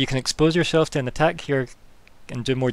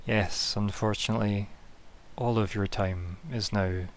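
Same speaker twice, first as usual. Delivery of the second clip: ominous death voice; 2 semitones lower, 5 dB quieter than usual. Acted. Death figure answers ominously.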